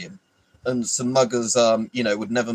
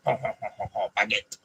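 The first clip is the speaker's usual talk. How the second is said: put-on French accent; 5 dB quieter than usual. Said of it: "Can I do a French accent?".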